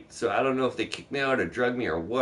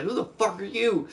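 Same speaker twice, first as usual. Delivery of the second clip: mock old lady voice, pitched high; high mock old lady demanding who's there; old lady yelling; 10 semitones higher than usual. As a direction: play the startled homeowner, high and sharp. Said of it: "and she's like".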